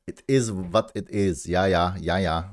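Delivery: ordinary talk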